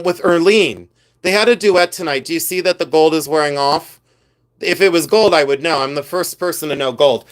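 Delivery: usual speaking voice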